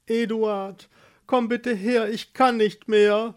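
high, suffering plea; slow moan for help. Acted. Pregnant Marleen calls husband for help, moaning. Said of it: "stöhnte Marleen".

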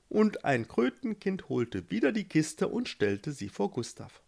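plain reading voice

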